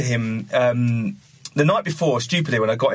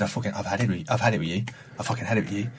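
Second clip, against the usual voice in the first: quiet seething rage; 6 semitones lower, 3 dB quieter than usual. Retold or imitated. Actor furious in trailer.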